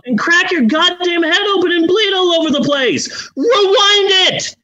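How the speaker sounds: very high, incredulous comic outrage; exasperated rant. Real, not acted. Host scolding the character for not rewinding, exasperated. Rant continues after listener laughs.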